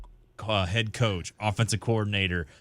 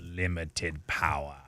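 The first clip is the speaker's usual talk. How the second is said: low, slow, softer villain voice; Palpatine; 4 semitones lower, 5 dB quieter, slower than usual. Acted. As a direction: savour it like an evil emperor. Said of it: "That is very Palpatine-esque".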